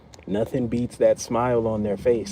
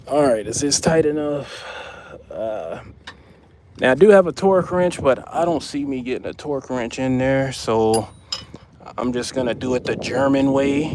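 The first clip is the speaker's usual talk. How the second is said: ordinary delivery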